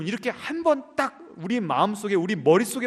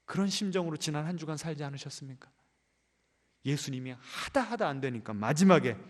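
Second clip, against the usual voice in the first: ordinary talk, nothing marked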